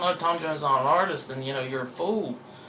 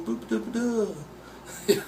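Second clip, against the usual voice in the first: higher, sing-song fake Liverpool babble; mock Liverpool accent; 8 semitones higher than usual. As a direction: imitate Liverpool speech in nonsense syllables. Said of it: "Look, that's Liverpool".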